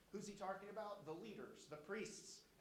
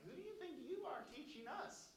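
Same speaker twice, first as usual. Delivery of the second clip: mocking sneer; 12 semitones higher than usual. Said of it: "They're mocking Isaiah".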